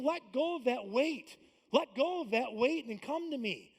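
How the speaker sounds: slow, loud and pleading; pleading invitation. Retold or imitated. Jesus voiced by the pastor inviting the weary, slow. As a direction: urge them gently but firmly to let go. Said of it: "He's saying".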